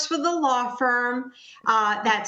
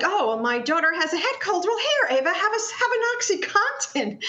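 much higher, breezy pretend mom; mock careless parent; 7 semitones higher than usual. Mother pretends to offer daughter Ava OxyContin, high mocking voice. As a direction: play a flippant mother handing out pills. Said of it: "it's not like".

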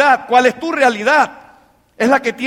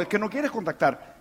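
low, much softer repeated answer; quiet rebuttal; 4 semitones lower, 10 dB quieter than usual. Acted. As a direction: state it quietly, unmoved. Repeats his deadpan answer. (respuesta baja e impasible)

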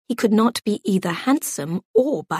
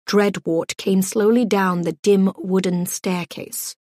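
usual speaking voice

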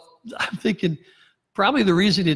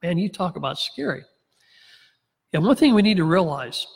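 ordinary talk

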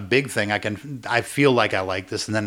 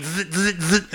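buzzing taser noise; 7 semitones higher, slower than usual. Retold or imitated. Imitating the taser.

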